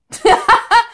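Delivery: short, high burst of laughter; real amused laugh. Real, not acted. Quiz taker amused by the generous verdict, laughing. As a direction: break into a high giggle.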